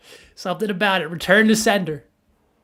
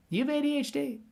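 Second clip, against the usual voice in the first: high and softer, a coy teasing repeat; softer teasing ask; 10 semitones higher, 7 dB quieter, faster than usual. Real, not acted. Teasing the artist Vinny with a hushed repeat. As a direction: lean in and ask it again, softer and sly. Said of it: "Vinny, quick question".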